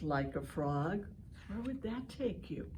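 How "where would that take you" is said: ordinary talk, nothing marked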